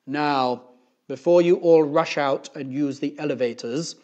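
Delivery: loud principal addressing the school; booming, authoritative announcement voice; Mister Kidswatter. A narrator's reading. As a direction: lay down rules over a loudspeaker. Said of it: "said Mister Kidswatter".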